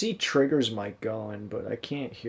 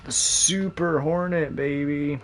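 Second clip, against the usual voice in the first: excited; 4 semitones higher, 6 dB louder, slower than usual. Real, not acted. Showing off his new jet.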